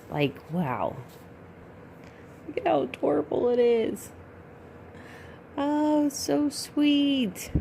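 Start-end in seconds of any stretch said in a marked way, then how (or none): 2.5-7.5 high-pitched gushing coo; adoring gush. Real, not acted. Collector admiring her new doll, gushing adoringly.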